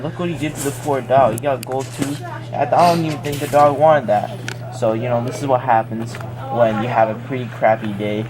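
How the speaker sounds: plain reading voice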